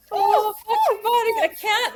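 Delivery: loud, high burst of being moved; overwhelmed cry. Real, not acted. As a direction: blurt it out, overcome by the story. Overwhelmed reaction to the story.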